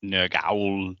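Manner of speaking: Welsh accent. Shown as a demonstration. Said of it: "a Welsh accent for this".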